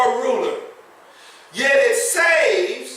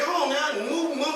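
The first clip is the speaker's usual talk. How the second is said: soft, half-hearted shooing; shooing the cat; 3 semitones higher, 7 dB quieter than usual. Retold or imitated. Youth pastor quietly shoos a cat away, a touch of impatience. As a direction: gently shoo the cat off the suit. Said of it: "he kept telling the cat".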